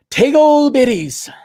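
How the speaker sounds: high, loud, drawn-out comic cry; mock holler. Hammed-up punchline to acted scene.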